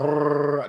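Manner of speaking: demonstrated tongue trill; attempted R roll. Shown as a demonstration. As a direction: try rolling an R by itself. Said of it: "I just can't be just like".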